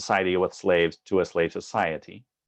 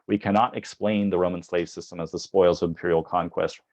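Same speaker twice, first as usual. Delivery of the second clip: ordinary talk, nothing marked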